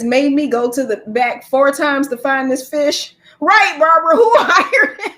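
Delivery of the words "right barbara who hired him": loud, high, laughing disbelief; incredulous question breaking into laughter; cracking up. Real, not acted. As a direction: burst into laughter mid-question. Cracking up at clerk's mistake.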